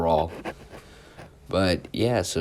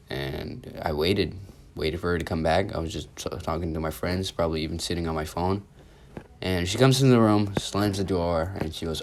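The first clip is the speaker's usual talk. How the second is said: ordinary talk, nothing marked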